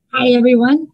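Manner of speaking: ordinary delivery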